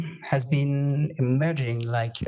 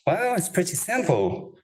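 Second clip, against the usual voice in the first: ordinary talk, nothing marked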